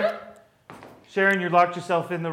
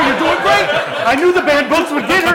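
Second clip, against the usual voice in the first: excited laughing; 11 semitones higher, 5 dB louder than usual. Real, not acted.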